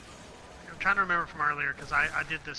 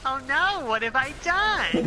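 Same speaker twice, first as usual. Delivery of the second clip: mock despair; 10 semitones higher, 5 dB louder, much slower than usual.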